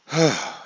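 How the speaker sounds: heavy relieved sigh; big exhale after a long effort; sigh of relief. Acted. Acting out collective group sigh.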